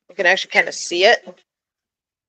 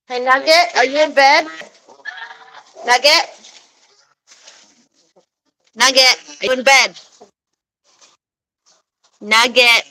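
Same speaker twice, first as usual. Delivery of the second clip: loud, high call into the coop; 6 semitones higher, 6 dB louder than usual. Real, not acted. Calling her hen Nugget in bed, loud high call.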